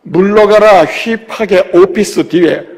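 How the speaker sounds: ordinary delivery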